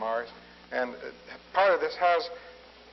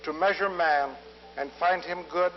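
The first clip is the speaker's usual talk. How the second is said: lyrical recitation of his own verse; poem reading; 4 semitones higher than usual. Acted. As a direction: recite it as a poem to a full hall. Reads his own poem aloud.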